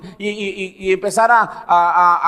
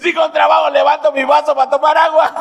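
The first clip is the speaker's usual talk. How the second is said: higher, near shouting in disbelief; amused exclamation; 7 semitones higher, 3 dB louder than usual. Retold or imitated. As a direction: exclaim that he can barely lift a glass. (exclamación divertida e incrédula)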